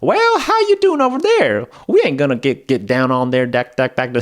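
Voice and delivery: hammed-up Southern accent, pitched high. Shown as a demonstration. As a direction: do a loud, cartoonish Southern accent that falls apart into gibberish. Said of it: "it's called a Southern accent".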